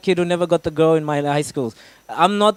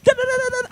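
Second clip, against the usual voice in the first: nervous scatting; faster than usual. Retold or imitated. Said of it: "I was still like".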